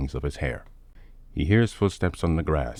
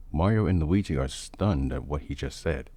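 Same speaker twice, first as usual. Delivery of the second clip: plain reading voice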